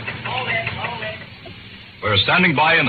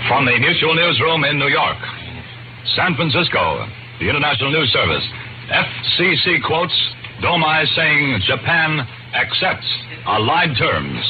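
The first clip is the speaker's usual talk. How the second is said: urgent radio news bulletin; news wire bulletin; 2 semitones higher than usual. Acted. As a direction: read it as breaking wartime news. Wartime radio bulletin read.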